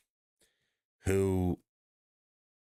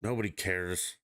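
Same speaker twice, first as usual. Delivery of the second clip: ordinary talk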